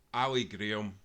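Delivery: ordinary delivery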